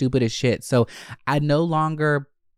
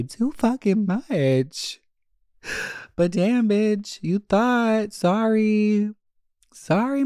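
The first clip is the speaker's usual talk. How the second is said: much higher, camp sassy voice; sassy diva voice; 9 semitones higher than usual. Acted. Teasing her listeners about the hookup, a high sassy diva voice.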